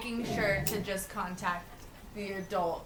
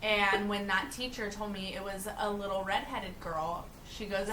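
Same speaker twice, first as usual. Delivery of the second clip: ordinary talk, nothing marked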